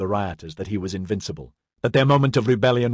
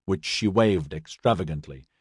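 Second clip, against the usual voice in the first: plain reading voice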